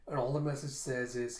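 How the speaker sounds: usual speaking voice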